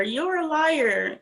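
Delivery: very slow, stretched-out you're a liar; drawn-out accusation. Retold or imitated. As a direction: drag out each word of you're a liar. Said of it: "when Stacey's like".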